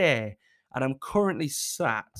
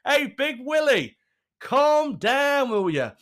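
higher, louder teasing call; 8 semitones higher, 5 dB louder, slower than usual. Pretend. Telling [name] to calm down, loud teasing call. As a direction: chide him to calm down. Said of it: "someone needs to tell [name]".

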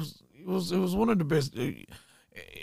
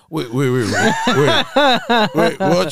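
loud, repeated waits climbing higher; mock alarmed disbelief; 5 semitones higher, 11 dB louder than usual. Man told girl is going home, loud comic alarm. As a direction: stammer it out in laughing protest. Acts out his alarm at her leaving.

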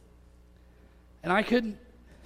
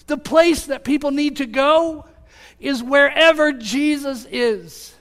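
slow, rising preacher's build, louder and higher; building sermon climax, slow and emphatic; preaching; 4 semitones higher, 6 dB louder, slower than usual. Acted. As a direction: drive each line home from the pulpit.

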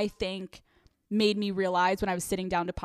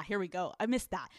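ordinary delivery

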